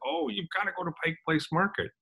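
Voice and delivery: bright quick tip from the desk; hotel front desk recommends the market, lightly voiced chirpy clerk; cheerful clerk. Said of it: "And they said".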